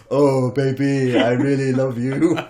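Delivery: high, loud, slow crooning seducer; hammed-up smooth lover. Narcissist crooning at woman, high slow hammed-up lover voice. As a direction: drag out the words like a cheesy lover.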